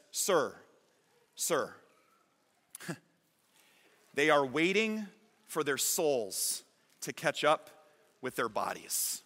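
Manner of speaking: soft, low, slow and calm. Retold or imitated. The translator calmly answers the tourist, soft and low. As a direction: gently settle the impatient man down. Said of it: "steps back and calmly says".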